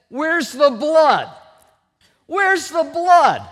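loud, slow, high-pitched demand; military father. Retold or imitated. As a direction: challenge a wimpy son at full volume, word by word. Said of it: "he was a military lifer".

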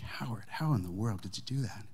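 whispered in hushed amazement; amazed whisper. Retold or imitated. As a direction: breathe the question in astonished disbelief. Amazed at the fixed door.

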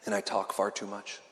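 right after sipping water. Real, not acted. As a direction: talk just after a drink of water. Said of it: "Forgive me as I drink some water".

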